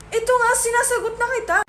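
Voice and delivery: high, giggling comeback; cheeky child laughing at mom; laughing retort. Acted. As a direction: laugh while talking back. Child talking back.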